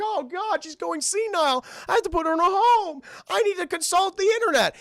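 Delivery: high, frantic squeaky voice; panicked dog. Acted. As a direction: fret out loud like a scared pup. Voicing panicked Courage.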